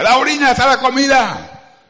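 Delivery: loud shout. Retold or imitated. Father comes home from the noisy machines, shouting. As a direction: bellow it like a deafened worker. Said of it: "habla despacio".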